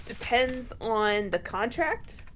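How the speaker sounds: slow, stretched words with a rising end; slow drawn out. Pretend. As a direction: draw out each word like a pronouncement. Riffing on vampire rules.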